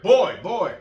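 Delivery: dad calling his son. Retold or imitated. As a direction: call him twice across the room. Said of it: "I'd just be like".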